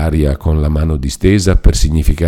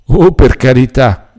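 higher-pitched, mildly impatient exclamation; grateful but impatient; 6 semitones higher than usual. A narrator's reading. Agnese declines the friar's offer, mildly impatient. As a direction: politely brush the offer aside. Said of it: "con quel misto di gratitudine e di impazienza".

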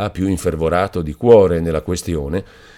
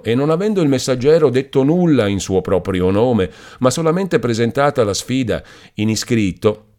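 plain reading voice

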